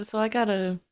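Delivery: ordinary talk, nothing marked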